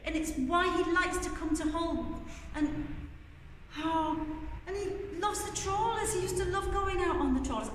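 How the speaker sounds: put-on high, fast fretting kids; high anxious chatter; worried children. A narrator's reading. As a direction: fret to each other like anxious children. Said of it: "talking among themselves".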